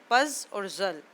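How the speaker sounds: पज and जल as separate parts; split into syllables. Shown as a demonstration. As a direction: set पज apart from और जल. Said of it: "divide कर देंगे दो सिलेबल में".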